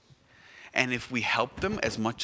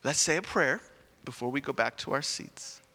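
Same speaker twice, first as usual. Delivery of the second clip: normal talking voice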